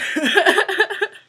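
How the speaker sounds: high, loud burst of real laughter. Real, not acted. Vlogger laughing at her own story, a high loud burst of laughter. Laugh after the funny quote.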